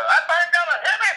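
high, loud all-out yell; yelled audition line. Retold or imitated. Auditioning actor yells his one line for the director. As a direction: belt it out at the top of your range. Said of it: "I yelled it out".

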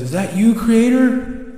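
high, loud question, climbing steeply; astonished calling out. Acted. Owl seeing a gentle light, calling out astonished. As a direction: call up in surprise, rising sharply.

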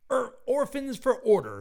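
high, loud headline shout; loud high screamed headline. Acted. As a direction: shout it out like a banner. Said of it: "screamed the headline".

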